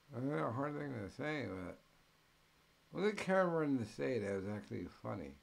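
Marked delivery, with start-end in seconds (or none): none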